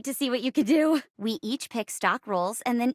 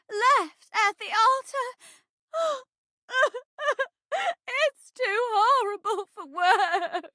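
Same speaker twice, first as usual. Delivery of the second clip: high, sobbing theatrical lament; melodramatic jilted bride; 10 semitones higher, slower than usual. Acted. Actress as bride left at the altar, melodramatic wail. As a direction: wail it in drawn-out stage anguish.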